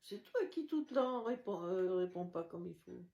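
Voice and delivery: usual speaking voice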